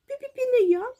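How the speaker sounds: loud, very high mouse squeak; piping storybook mouse. A narrator's reading. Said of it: "пищить кот".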